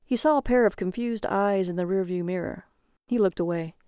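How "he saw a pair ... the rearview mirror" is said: plain reading voice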